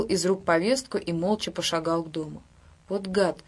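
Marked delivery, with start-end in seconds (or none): none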